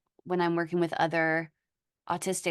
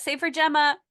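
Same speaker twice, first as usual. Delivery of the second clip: a high playful squeal returning the compliment; playful squeak; 10 semitones higher, 5 dB louder than usual. Real, not acted. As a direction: repeat it much higher and a bit louder. Playful echo back to Gemma.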